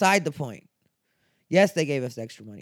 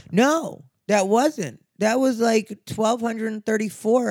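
loud, high, insistent correction; emphatic outburst; 7 semitones higher, 4 dB louder, slower than usual. Real, not acted. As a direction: push back hard, voice up. Heated rebuttal of email dismissal.

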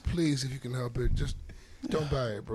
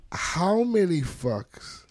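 slow deliberate; 6 semitones higher, much slower than usual. Acted. Tongue-twister parody, slow.

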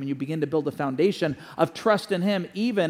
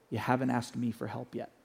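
gentle father; 4 semitones lower, 6 dB quieter than usual. Retold or imitated. Father leans in and speaks.

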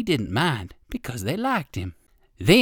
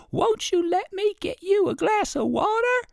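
little ant voice. Acted. Little red ant praising the rabbit, very high squeaky voice. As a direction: voice a tiny admiring ant, way up high. Tiny ant offers water.

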